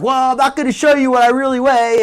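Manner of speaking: loud, high imitation of a stubborn fighter; mock refusal. Host imitates Amber refusing the weigh-in, mock stubborn refusal. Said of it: "and she's like".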